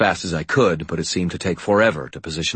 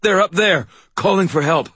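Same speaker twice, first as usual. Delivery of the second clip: urgent; 13 semitones higher, 5 dB louder than usual. Acted. Injured Harry urging landlady.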